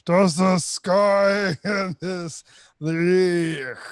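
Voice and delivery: very slow, choppy broken-up reading; voice cutting out. Pretend. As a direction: read like a bad connection dropping out. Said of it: "do it like that".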